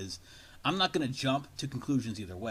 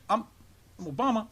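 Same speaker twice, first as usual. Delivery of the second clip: mock Obama; 8 semitones higher than usual. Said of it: "that's the way he sounds to me".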